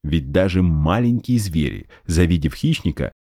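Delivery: usual speaking voice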